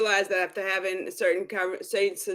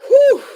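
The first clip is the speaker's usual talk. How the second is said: relieved whew; 13 dB louder than usual. Retold or imitated. Relieved exhale after heavy reading.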